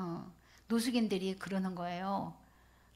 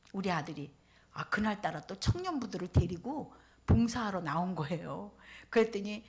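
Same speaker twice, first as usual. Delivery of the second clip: usual speaking voice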